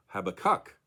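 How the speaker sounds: Habakkuk stressed at the end, hab-a-KUK; stress on final syllable. Shown as a demonstration. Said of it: "on the third and final syllable".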